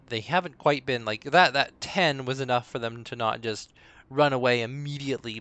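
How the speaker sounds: ordinary talk, nothing marked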